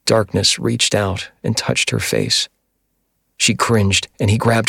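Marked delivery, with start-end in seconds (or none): none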